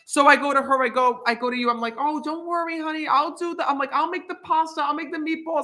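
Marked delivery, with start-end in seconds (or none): 2.0-5.6 chirpy reassurance. Retold or imitated. Cheerfully reassuring sick wife.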